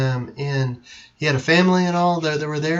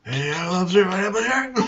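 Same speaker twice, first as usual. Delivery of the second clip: wobbly pirate impression, pitch rising; badly faked film pirate, words smeared together; Johnny Depp; 2 semitones higher, 6 dB quieter than usual. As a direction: run it together like a woozy pirate. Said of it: "That's my terrible Johnny".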